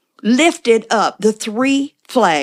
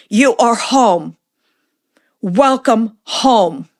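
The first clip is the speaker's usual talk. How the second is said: slow tender welcome; much slower than usual. Retold or imitated. Greeting elder with tender welcome.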